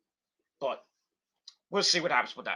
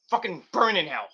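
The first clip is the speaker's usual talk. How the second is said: ordinary talk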